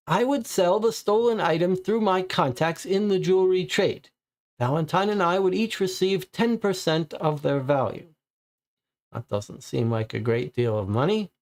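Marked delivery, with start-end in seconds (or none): 0.1-8.1 high, anxious suspect voice; nervous suspect. Acted. Papineau answers the police, as a high nervous suspect. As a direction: play the frightened informer, pitched well up.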